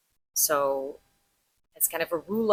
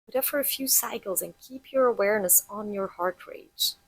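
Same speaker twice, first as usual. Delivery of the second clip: guided meditation; 4 semitones higher than usual. Acted. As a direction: lead them gently into noticing the heartbeat. Guides heart-rate breathing exercise.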